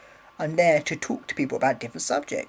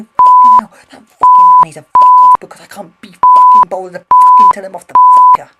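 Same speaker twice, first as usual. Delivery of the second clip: screeched very high and loud, a wild rocker; 20 dB louder than usual. Retold or imitated. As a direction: scream it like a wasted rock star. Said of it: "He uses all those swear words".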